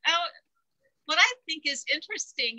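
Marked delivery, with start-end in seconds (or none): none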